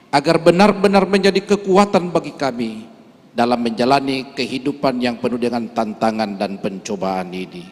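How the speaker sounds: normal talking voice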